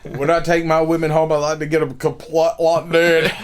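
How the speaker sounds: hammed-up Scottish brogue; mock Scottish accent. Joking about taking women home, mock Scottish accent. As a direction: boast in a joke Scottish accent. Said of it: "like, Scottish".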